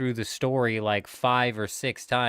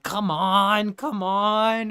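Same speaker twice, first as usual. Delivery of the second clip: put-on impatient kid; 8 semitones higher, 5 dB louder, much slower than usual. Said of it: "he's like".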